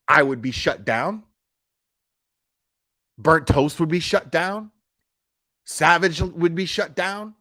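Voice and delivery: ordinary talk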